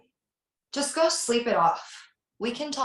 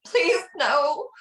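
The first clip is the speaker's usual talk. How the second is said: high, louder begging; desperate plea; 5 semitones higher, 5 dB louder than usual. Acted. Terrified victim begging the caller not to kill. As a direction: plead for her life.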